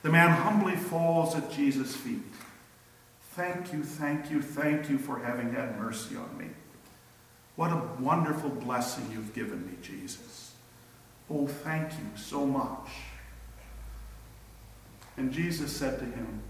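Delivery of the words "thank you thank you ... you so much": soft, breathless, humble thanks; a faint touch of the breathless healed man. Retold or imitated. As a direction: murmur it, spent and grateful at his feet. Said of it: "probably by now out of breath".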